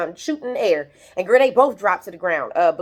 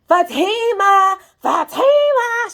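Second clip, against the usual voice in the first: high, drawn-out, loud calling of a name; yelling a name; 12 semitones higher, 6 dB louder, much slower than usual. Retold or imitated. Angela at the door, yelling up to Fatima, high and drawn out. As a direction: stretch the name out high and loud, like a yell upstairs. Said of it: "She just yelling".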